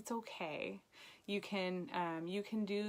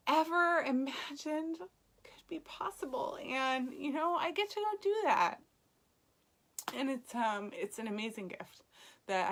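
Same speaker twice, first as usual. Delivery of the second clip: tearing up; 5 semitones higher than usual. Real, not acted. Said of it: "Not gonna cry, but I totally teared up".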